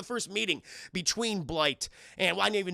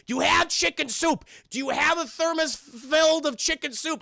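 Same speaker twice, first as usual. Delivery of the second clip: suddenly high, loud, urgent question; a mentor blurting out an odd tip; old Bruce advising the young hero, high and loud; earnest old mentor; 10 semitones higher, 6 dB louder than usual. Acted. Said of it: "Bruce is, like, giving advice".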